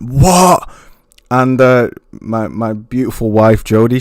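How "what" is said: loud, higher stunned "What?"; imitating a shocked yell; retold shocked shout. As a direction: blurt it out in disbelief. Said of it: "I just went".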